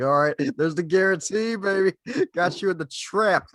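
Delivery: high, loud, laughing gloat; mock gloating hype man. Host gloating over a guest who took the bait, loud and laughing. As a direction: crow over the trap, laughing. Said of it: "It's like".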